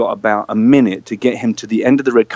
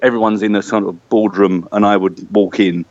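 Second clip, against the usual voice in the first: normal talking voice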